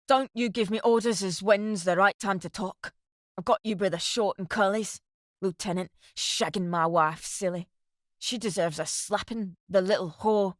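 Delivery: plain reading voice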